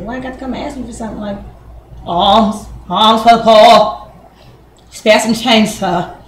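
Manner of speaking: mock beggar. A woman mocks asking her man like a beggar, pleading.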